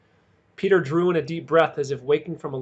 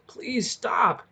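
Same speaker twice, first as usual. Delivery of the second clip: gasping plea; 6 semitones higher than usual. A narrator's reading. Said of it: "Cole gasped".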